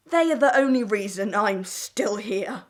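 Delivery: normal talking voice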